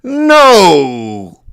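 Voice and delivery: mock-sob the refusal; whiny refusal. Told of a French car instead, mock-crying no. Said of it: "I'm like".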